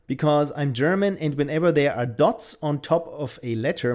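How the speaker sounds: ordinary talk, nothing marked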